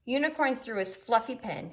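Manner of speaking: ordinary delivery, nothing marked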